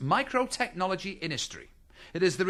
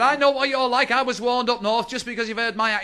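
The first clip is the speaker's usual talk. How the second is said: higher and louder, stubborn; loud insistence; 9 semitones higher, 7 dB louder than usual. Acted. Liverpool shopper refuses the half price, loud and stubborn. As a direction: insist at full volume.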